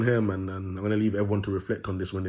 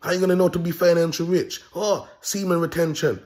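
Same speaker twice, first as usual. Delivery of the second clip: higher, louder mock of online gurus; lightly mocking imitation, raised voice; mocking other video makers; 8 semitones higher, 6 dB louder than usual. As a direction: mimic preachy video makers, pitched up. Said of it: "put out videos and say".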